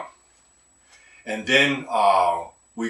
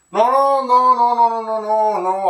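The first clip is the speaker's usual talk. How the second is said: panicked no; 12 semitones higher, 8 dB louder than usual. Real, not acted. Panicked reaction to camera mishap.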